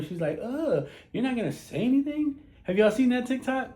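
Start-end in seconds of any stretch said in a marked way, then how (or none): 0.4-2.4 higher-pitched mock exasperation; annoyed girlfriend. Girlfriend to her silent man on his phone, mock annoyed. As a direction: imitate an irritated woman prodding her man.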